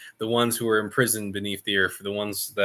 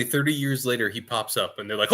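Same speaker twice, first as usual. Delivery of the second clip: normal talking voice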